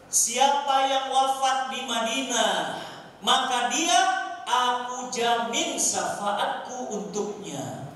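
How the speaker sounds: slow, loud, ringing recitation; proclaimed hadith. Retold or imitated. Preacher quotes the Prophet's promise to the congregation, slow proclamation. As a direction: declaim it phrase by phrase, drawn out.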